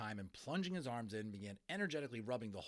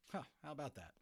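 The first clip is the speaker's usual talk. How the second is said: plain reading voice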